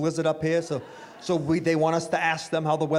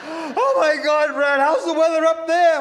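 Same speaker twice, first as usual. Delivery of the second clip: high, loud panicked voice; frantic friend; 9 semitones higher, 6 dB louder, slower than usual. Retold or imitated. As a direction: gasp it out in alarm. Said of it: "She goes".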